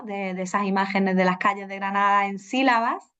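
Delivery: usual speaking voice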